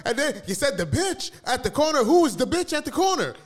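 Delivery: high-pitched, loud parody of a misquote; mock accusation. Mimicking a listener who misheard everything, mock accusing. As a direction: throw out the absurd charge, hammed-up.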